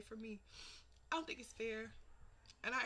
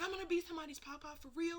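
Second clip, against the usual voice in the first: retold excited dad, high and quick; 7 semitones higher, much faster than usual. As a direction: imitate a thrilled grandfather-to-be, fast. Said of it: "And they were so excited".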